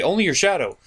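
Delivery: ordinary talk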